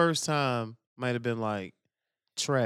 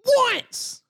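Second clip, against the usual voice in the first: "Once" shrieked high and loud; hammed-up high shout; all-caps shout; 6 dB louder than usual. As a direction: yell it like it's written in capitals. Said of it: "All caps".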